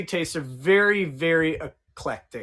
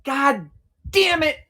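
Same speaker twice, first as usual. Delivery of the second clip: exasperated; 8 semitones higher, 4 dB louder, slower than usual. Retold or imitated. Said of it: "I was like".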